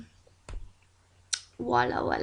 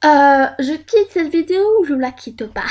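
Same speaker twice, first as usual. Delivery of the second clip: louder voice of a wavering viewer; imagined viewer; 3 semitones higher, 9 dB louder than usual. Acted. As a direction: lightly act out a viewer hesitating loudly. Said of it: "Vous êtes en mode".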